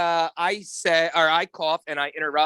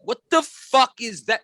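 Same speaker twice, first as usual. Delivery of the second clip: rising, higher-pitched annoyance at himself; mock exasperated rant; 7 semitones higher than usual. Podcaster hearing himself on playback, mock exasperation. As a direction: build from baffled question to fed-up outburst. Retells reacting to his recorded noises.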